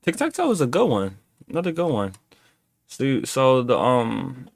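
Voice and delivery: ordinary delivery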